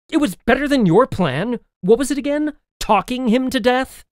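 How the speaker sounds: sarcastic retort. Acted. Snapping back sarcastically.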